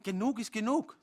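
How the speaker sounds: high, loud final word; fed up. Acted. Angry partner Joan ends the fight, loud and high. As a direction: slam the door on the talk.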